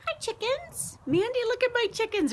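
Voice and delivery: high sweet voice; cooing at chickens. Real, not acted. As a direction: coo at the chickens.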